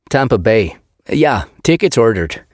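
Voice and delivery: put-on blunt Swedish accent correction; heavy Swedish accent, matter-of-fact. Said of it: "his Swedish accent thick".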